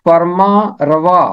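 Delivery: फरमा रवा slow and deliberate; teacher demonstrates फरमा रवा; Urdu pronunciation. Shown as a demonstration. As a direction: model फरमा रवा carefully for the class. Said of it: "इसका तलफ़ुज़ है".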